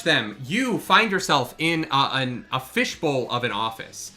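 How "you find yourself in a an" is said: ordinary talk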